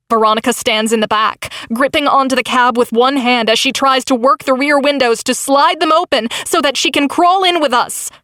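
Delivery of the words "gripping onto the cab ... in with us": ordinary delivery, nothing marked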